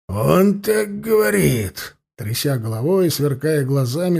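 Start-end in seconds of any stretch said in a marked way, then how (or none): 0.1-2.0 slow, higher, dragged-out cry; shouting. A narrator's reading. Prisoner at the interrogator's news: slow outraged shout. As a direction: fling it out, outraged. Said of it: "закричал Иванов".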